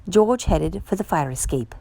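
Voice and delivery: ordinary delivery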